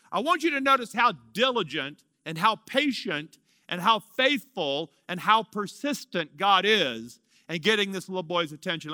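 ordinary delivery

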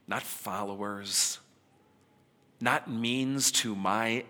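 hushed and slow, lingering on friends; tender reassurance. Retold or imitated. Jesus tells disciples they're friends.

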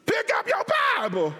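high-pitched hollered command; emphatic shout. Real, not acted. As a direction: holler it so they won't forget. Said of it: "say it in such a way you won't forget".

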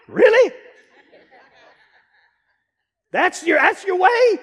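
laughing, mock-astonished; incredulous. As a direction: scoff at the idea, laughing. Mocking reply to girdle ad.

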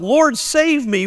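slow plea, pitched well up; a psalmist's slow, high cry; crying out to God. Retold or imitated. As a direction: lift it high and draw it out like a cry for help. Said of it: "the psalmist cries out".

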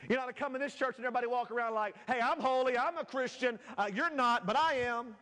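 high, forceful pulpit mockery; impassioned preaching. Preaches and mocks the holier-than-thou.

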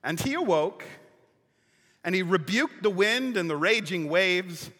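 usual speaking voice

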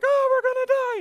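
high loud wail; mock panic. Pagan sailors fearing the storm, mock panic.

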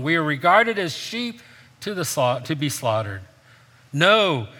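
reading the verses out with rising force; scripture reading. Acted. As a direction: read Romans to the church, building up. Reads scripture aloud to congregation.

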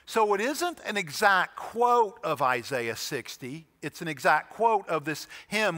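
ordinary delivery

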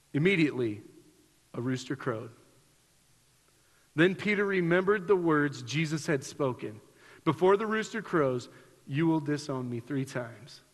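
measured Bible reading voice; scripture reading. Acted. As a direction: read the passage gravely to the congregation. Reads scripture passage aloud.

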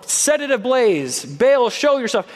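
calling out. Retold or imitated. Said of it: "Called out to Baal".

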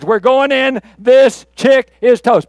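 loud, high, punchy war cry; battle charge. Acted. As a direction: charge in with full bravado. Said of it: "there's his charge".

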